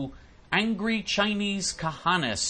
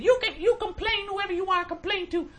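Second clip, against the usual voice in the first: imitating the supervisor. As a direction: parody her as dismissive. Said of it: "so she said".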